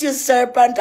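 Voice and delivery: normal talking voice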